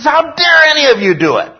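high shouted rebuke; indignant shout at a whole crowd; emphatic outrage. Acted. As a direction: hurl it at them, loud and high all through. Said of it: "He is really emphatic about this".

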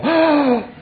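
sharp gasp then a very high "Oh!"; breathless high-pitched "Oh!" of awe; amazed gasp. Pretend. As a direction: gasp in wonder, voice leaping up.

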